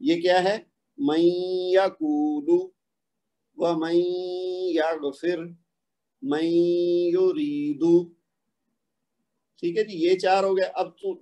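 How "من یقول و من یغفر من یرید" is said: من یقول, من یغفر, من یرید each very slow, noon merged with nasal hum; تلاوت کا انداز; slow tajweed recitation. Shown as a demonstration. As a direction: recite each example slowly, merging the noon. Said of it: "اسی طرح نیچے دیکھیے".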